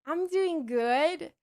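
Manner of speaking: very slow, drawn-out dopey reply; drugged out. Retold or imitated. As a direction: act heavily sedated. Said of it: "I drugged out, drugged out".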